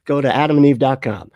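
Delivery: loud, punchy sponsor pitch; ad read. Acted. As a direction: sell it like a radio ad.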